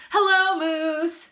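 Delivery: high, slow sing-song hello; children chanting hello in unison; class in chorus. A narrator's reading. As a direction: greet like a whole class together. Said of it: "said the class".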